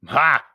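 single loud, high "Ha!"; scornful laugh. A narrator's reading. Stranger scoffing at Anthony's instructions, loud laugh. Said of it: "laughed the other".

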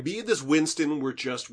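normal talking voice

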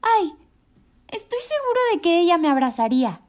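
high, loud, tearful chick's plea; a small bird sobbing out his hope; sobbing little bird. A narrator's reading. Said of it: "dijo Choco entre sollozos".

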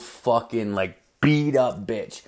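usual speaking voice

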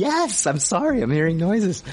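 high-pitched, fed-up apology; exasperated whining. Real, not acted. Host tells co-host the noises persist, high exasperated whine. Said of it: "I'm just pissing and moaning".

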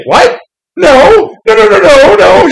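high, loud, frantic string of no's; panicked denial. Retold or imitated. Nightwing denying he likes Batman, in a loud panicked squeal. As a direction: protest wildly, voice climbing.

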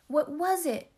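high, loud, cross demand; sharp snap. A narrator's reading. Ancient half-sister to the giggling girl, loud snap. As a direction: snap the question at a child. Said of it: "snapped the ancient one".

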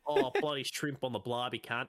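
hammed-up outback wildlife host; exaggerated Australian accent; Crocodile Hunter Aussie. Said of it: "turn into the crocodile hunter".

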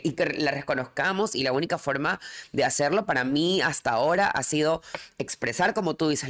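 usual speaking voice

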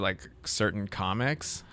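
ordinary talk, nothing marked

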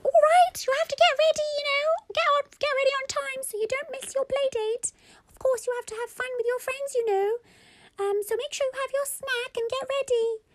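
very high-pitched squeaky mum; squeaky toy voice. Acted. Mummy answers Baba about lunch, squeaky voice. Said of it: "Mummy's voice was hilarious".

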